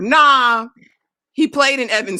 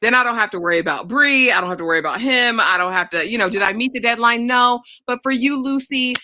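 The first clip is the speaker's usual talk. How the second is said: ordinary talk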